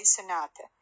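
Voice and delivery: usual speaking voice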